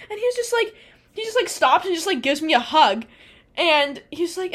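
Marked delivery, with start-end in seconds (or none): none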